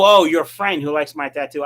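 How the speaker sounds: ordinary talk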